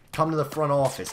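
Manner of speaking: mock New Jersey receptionist call; New Jersey accent. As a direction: school receptionist calling a student to the front office, light New Jersey accent. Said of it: "whenever I think of a New Jersey accent".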